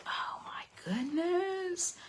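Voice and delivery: breathless slow hush, then a quick whisper aside; almost speechless at the pretty box; whispered awe; awed whisper. Real, not acted. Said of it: "I can't even talk right now".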